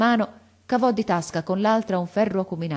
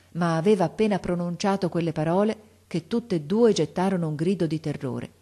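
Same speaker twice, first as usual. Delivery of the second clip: ordinary delivery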